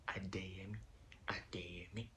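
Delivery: unsure whispered name; whispering. Real, not acted.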